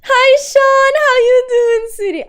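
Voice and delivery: high sugary voice greeting a chatter; high cutesy voice greeting a viewer by name. Acted.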